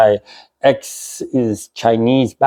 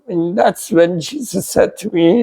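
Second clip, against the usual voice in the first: ordinary delivery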